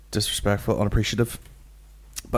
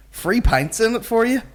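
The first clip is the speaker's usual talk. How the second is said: mock pub landlord; 13 semitones higher, 4 dB louder than usual. Mocking pub owner offer.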